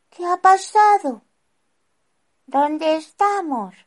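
put-on high, loud, startled children; confused. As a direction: wake up bewildered. Both wake confused.

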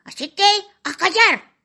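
high haughty fairy scolding; winter fairy voice. Acted. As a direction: look down on them, tiny and sharp.

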